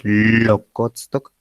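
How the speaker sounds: long drawn-out, vibrating L starting "locotstok"; exaggerated initial L. Shown as a demonstration. Teacher exaggerates a sound for learners, "locotstok" with long buzzing L. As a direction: hold the L of "locotstok", fully voiced. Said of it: "Lo voy a hacer, este, muy, muy exagerado".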